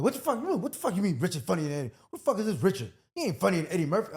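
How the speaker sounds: high, loud, fast disbelief; what-the-hell shock, rattled off fast; incredulous outburst. Retold or imitated. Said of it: "I'm like".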